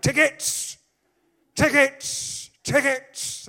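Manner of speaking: loud, high, irritated calls; irritated ticket collector. Acted. Ticket collector demanding tickets on the train, loudly irritated. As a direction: call it out sharply like a fed-up collector. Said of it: "he's irritated. He's like".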